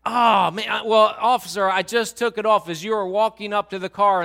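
lightly hammed-up husband making excuses; protesting husband. Husband excuses missing seatbelt to officer, protesting loudly.

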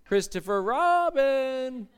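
lightly voiced Pooh Bear, slow and low at the end; a mild Eeyore-ish sigh on bother; calm Pooh. Pastor voicing Pooh to show calm over panic, slow and low. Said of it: "he just kinda in a composed kind of setting".

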